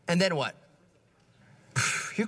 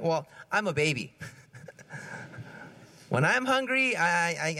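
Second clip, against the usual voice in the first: normal talking voice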